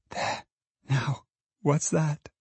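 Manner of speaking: hushed, slow, secretive question; as if afraid of being overheard; very softly. A narrator's reading. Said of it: "and speaking very softly".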